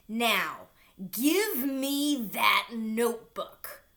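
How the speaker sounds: loud, slow, growling demand; monster yell. A narrator's reading. Monster demanding the notebook from Nikki, slow loud yell. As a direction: bellow it out like a monster. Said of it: "the monster yelled".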